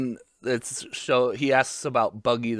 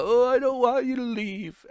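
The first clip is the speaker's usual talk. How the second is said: mock crying; 10 semitones higher than usual. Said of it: "Luffy is, like, crying".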